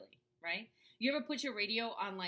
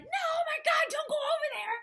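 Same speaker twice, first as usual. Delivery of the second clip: high, loud, frantic alarm; panicked fear voice; 10 dB louder than usual. Shown as a demonstration. Fear voice yelling a warning, frantic and high. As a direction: shriek it like fear taking over. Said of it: "That's fear".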